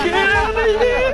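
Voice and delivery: high, slow, loud hype shout; frat bro. Real, not acted. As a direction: stretch it out, screaming in victory. Victory celebration after team wipe.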